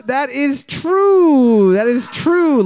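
drawn-out, loud, high announcement of the answer; excited reveal. Real, not acted. Quiz host to the teams, a big excited reveal. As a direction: stretch out "That is true" like a big game-show reveal. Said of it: "Boards that we're looking for".

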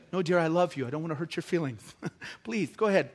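soft meek pleading husband, laughing through it; mock timid husband. Pastor mocks a wimpy husband giving in to his wife, softly. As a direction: play the spineless husband meekly.

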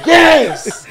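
high, loud laughing cheer; cheering manager. Retold or imitated. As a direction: celebrate the goal with a shout. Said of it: "That's the manager's one".

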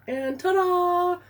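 high, slow showman ta-da; ta-da flourish. Real, not acted. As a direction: present it with a flourish. Presenting the party idea.

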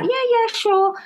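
high, breezy yeah yeah sure; breezy agreement. Retold or imitated. Agreeing breezily to her daughter, high and airy. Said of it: "And I was like".

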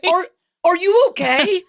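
high, halting imitation of alarmed bystanders; concerned onlookers. Passengers checking on someone who fell, alarmed. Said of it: "all of them are like".

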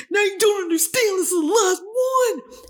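high, wailing mock sobs; hammed-up crying. Protesting to mom over lost soup, hammed-up crying. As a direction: plead through exaggerated fake tears. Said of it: "a full mental breakdown".

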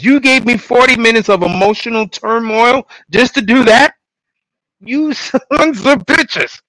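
hammed-up betrayed outrage, slowing on the insults; outraged rant. Host rants at the show's writers in mock outrage. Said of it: "I'm like".

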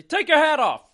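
high and loud, calling out; loud mock demand. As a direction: bark it at the impostor.

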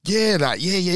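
normal talking voice

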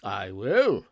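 loud, emphatic promise; loud cry. A narrator's reading. Gentleman accepting Scrooge's invitation, loud cry. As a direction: cry it out heartily. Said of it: "cried the old gentleman".

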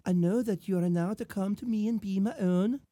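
put-on lightly girly love-letter reading in an accent; measured, accented mock-romantic woman; Belle. Woman writing to her suitor, mock-romantic accented voice. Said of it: "I'll read it in Belle's voice".

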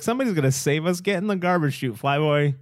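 high, fast, impatient comeback; acted bossy retort. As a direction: imitate a princess taking charge. Leia's retort in acted quarrel.

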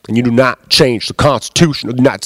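mocking Southern accent, lightly done. Comedian mocking constitution purists in a light Southern accent. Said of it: "it's just way too easy to make that Southern accent".

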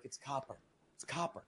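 very soft, faint deathbed rasp; weak last words on a deathbed; dying whisper. Retold or imitated. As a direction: fade out like a dying man. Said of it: "on my deathbed".

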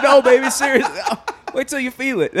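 high, loud voice breaking into laughter; mock pleading. Imagined big man reassuring a lover, mock pleading.

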